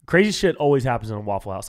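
ordinary talk, nothing marked

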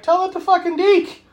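very high, incredulous heckle; mock indignant retort. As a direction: squeal back at the film in disbelief. Heckling the character's claim.

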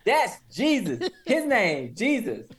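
high, slow, emphatic imitation of a tech; insistent spelling-out. Tech insisting on the customer's name, slow mock indignation. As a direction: spell it out as if obvious. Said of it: "It's like".

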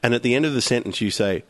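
ordinary talk

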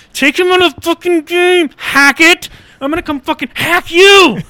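high, loud enraged yell at the coach; furious yell. Retold or imitated. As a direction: roar it at the coach through the screen. Raging at coach Hackett.

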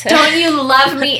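mock a dog begging for affection; needy dog voice.

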